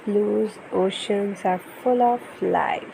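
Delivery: put-on lively, sing-song storybook reading for a toddler; reading to children. Parent reading an ocean picture book to baby, animated storytelling. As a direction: read it brightly to hold a little one's attention.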